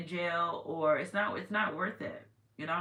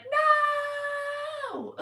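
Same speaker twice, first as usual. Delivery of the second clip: a shrieked high no, much higher and louder; mock refusal shout; 5 dB louder than usual. Karma voiced refusing, a high mock shriek. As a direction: squeal the no like a panicked karma. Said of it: "This karma's like".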